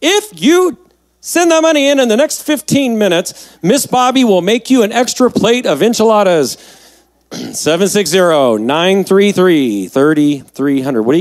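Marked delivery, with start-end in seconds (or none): 0.0-6.6 high, pushy TV pitchman voice; infomercial. Pretend. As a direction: sell it like a late night ad. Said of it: "I sound like an infomercial".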